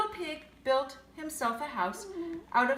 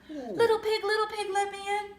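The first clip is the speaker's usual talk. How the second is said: high, loud wolf calling at the door; a playful wolf knocking, louder and higher; 7 semitones higher, 4 dB louder than usual. Acted. Wolf at the pig's door, calling loud and high. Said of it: "said the wolf".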